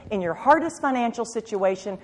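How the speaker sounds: ordinary talk, nothing marked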